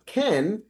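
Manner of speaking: CAN set apart, pitched high; "can" singled out with high emphasis; stress on CAN. Real, not acted. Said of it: "I stress the word can".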